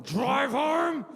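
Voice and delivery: slow, rising furious vow; angry outburst. Acted. Grown son snapping at his mum's instruction, angry outburst. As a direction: build to a strained, drawn-out yell. Explodes after quiet farewell.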